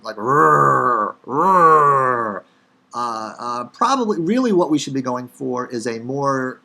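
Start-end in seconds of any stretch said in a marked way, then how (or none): 0.2-2.4 RRR as a long, loud American growled r; Chicago R. Shown as a demonstration. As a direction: hold the rrr, exaggerated Chicagoan. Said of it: "my R's tend to sound like".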